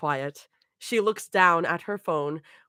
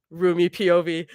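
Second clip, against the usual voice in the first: ordinary talk, nothing marked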